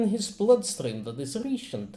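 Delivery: put-on doctor with a light Russian accent. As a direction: speak English with a Russian lilt. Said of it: "I like my Russian accent".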